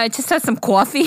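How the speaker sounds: hammed-up Long Island vowels on "coffee"; heavy Long Island accent. Shown as a demonstration. Answering about last week's dinner, heavy Long Island accent. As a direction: stretch "coffee" into a thick Long Island "cwoffee". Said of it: "just not as heavy".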